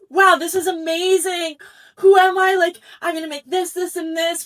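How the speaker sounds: high, loud, excited self-amazement; giddy confidence. Retold or imitated. Designer reliving her ideas for the denim challenge, giddy. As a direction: marvel at your own brilliance, voice lifted.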